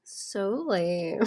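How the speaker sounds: lightly voiced valley girl drawl; hint of a valley girl; mock valley girl. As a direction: toss it off like a bored valley girl. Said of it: "I just say dude and bro and lame".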